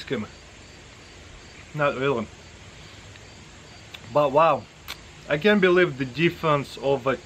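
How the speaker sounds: talking while eating; mouth full chewing. Real, not acted. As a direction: food in mouth. Seen 0: Sniffs spoon first, spoon enters mouth at 3.1, then chews.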